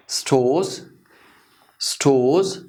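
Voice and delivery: स्टोर्स as "stohz", R dropped. Shown as a demonstration. Teacher modelling for learners, स्टोर्स with silent R. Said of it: "हम इस आर को नहीं pronounce करेंगे".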